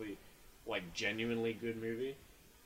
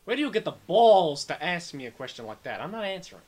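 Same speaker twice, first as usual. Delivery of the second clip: higher, loud indignant Pesci; Joe Pesci impression; 6 semitones higher, 12 dB louder than usual. Joe Pesci refusing a rude question, loud and indignant. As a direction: bristle like an offended Joe Pesci. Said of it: "Joe Pesci's just like".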